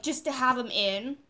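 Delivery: usual speaking voice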